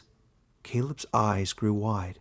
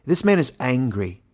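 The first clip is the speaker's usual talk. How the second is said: louder, growled accusation; 3 semitones higher, 7 dB louder than usual. A narrator's reading. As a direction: snap it out like a wolf. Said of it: "she growled".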